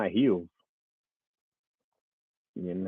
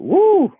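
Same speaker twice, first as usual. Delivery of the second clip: high, loud "woo"; whooping cry; 9 dB louder than usual. Retold or imitated. Dad recalls racing his ten-year-old, loud high whoop. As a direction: let out a sudden high whoop.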